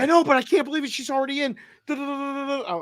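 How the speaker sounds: high-pitched, flustered wife; nervous wife. Retold or imitated. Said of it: "She's like".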